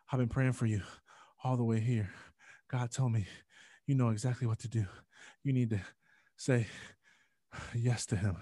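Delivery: out of breath. Retold or imitated. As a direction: imitate grandma panting after rushing in. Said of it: "She's out of breath".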